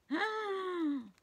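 high mock-shocked gasp. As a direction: gasp in pretend horror at the cat. Dinosaur flings a mug at cat.

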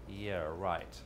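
low, soft sarcastic yeah, right; sarcastic inner voice. Retold or imitated. Teacher voicing the subconscious's quiet sarcastic doubt. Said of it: "then your subconscious is saying".

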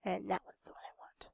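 low sneaky whisper. Real, not acted. Gamer eyeing the target bot, sneaky whisper. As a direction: whisper it like a hunter eyeing prey.

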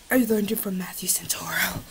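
whispered aside; sheepish whispered admission. Real, not acted. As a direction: whisper it like a secret confession. Confiding aside.

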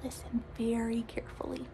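hushed whisper; soft classroom whisper. Shown as a demonstration. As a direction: whisper so they lean in. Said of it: "I'm going to show you some soft air".

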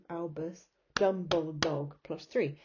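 ordinary delivery

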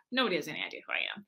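low, quick, near-whispered aside; hushed aside. Real, not acted. Unknown attendee at the back of the room, hushed aside. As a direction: drop low and hurry it out.

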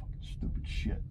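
whispered under his breath; muttered curse. Real, not acted. Boss swears quietly while waiting on late worker, whispered. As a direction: hiss it to yourself, fed up. Cursing to himself, frustrated.

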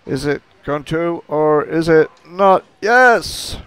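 high, rising suspense, then a loud exultant Yes; suspense bursting into triumph. Real, not acted. Bowling narrator awaiting the result, tense then jubilant. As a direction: build the tension, then explode on Yes.

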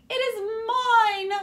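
high, loud, insistent demand. A narrator's reading. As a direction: claim it at the top of her voice. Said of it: "she demanded".

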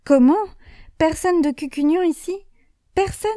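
Comment lancé fort, puis Personne de plus en plus aigu; s'étrangler d'étonnement; stupeur incrédule. Acted. Le curé apprend que la page est vide. (le curé, stupéfait, refuse de croire Saint-Pierre)

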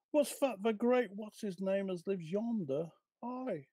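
Cockney washerwomen trading news; cockney gossip. Acted. The washerwomen gossip about the squire, in a Cockney accent. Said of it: "can you please read for us in your best cockney accent".

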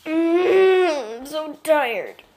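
loud drawn-out whine; tired moan. Real, not acted. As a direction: groan it out.

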